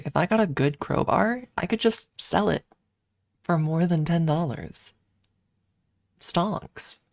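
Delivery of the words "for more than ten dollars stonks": usual speaking voice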